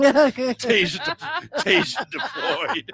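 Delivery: slow, high mock alarm voice; drawn-out high robotic call; taser call.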